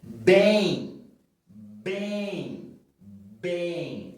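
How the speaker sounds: first "Bem" loud, then "Bem" as nasal ei with no M; nasal ending shown. Shown as a demonstration. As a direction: say "Bem" as bẽj, no final M. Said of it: "Como que nós falamos essa palavra". ("Bem" com ditongo nasal, sem pronunciar o M)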